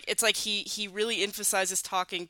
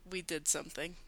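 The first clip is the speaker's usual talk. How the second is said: much softer, lower deadpan quote; calm imitation; 4 semitones lower, 12 dB quieter than usual. Host doing justice to Phil's response to magic, calmly. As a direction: drop it low and unexcited. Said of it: "he says it so calmly".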